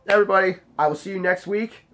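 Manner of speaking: ordinary delivery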